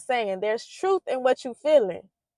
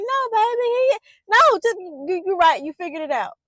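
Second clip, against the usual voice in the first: much higher, flustered fake denial to a child; mock flustered parent; 8 semitones higher, 5 dB louder than usual. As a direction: play the caught-out parent, hammed-up. Parent denying Santa Claus.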